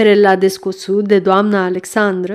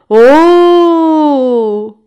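long drawn-out "oh", higher and louder; whistling exclamation; 6 semitones higher, 5 dB louder than usual. A narrator's reading. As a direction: draw it out like a whistle of discovery. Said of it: "exclamă Fanferlot, șuierând". (exclamație prelungă, ca un șuierat)

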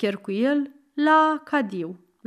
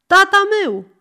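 insistent shout; 9 semitones higher, 7 dB louder, faster than usual. Acted.

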